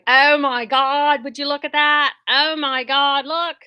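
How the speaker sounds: excited Australian-ish gasp, slowed at the start; mock Australian awe at something amazing; Australian accent. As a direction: imitate a British reactor drifting Australian. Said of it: "going a little Australian there".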